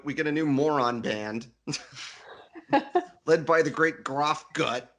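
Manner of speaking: ordinary delivery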